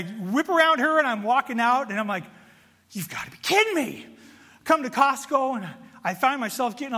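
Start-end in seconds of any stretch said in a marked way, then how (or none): 2.9-4.1 high, exasperated mock outrage; dramatic disbelief. Walking out of Costco, hammed-up disbelief. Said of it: "being dramatic for the point of emphasis".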